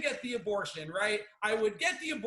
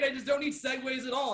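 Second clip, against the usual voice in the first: ordinary delivery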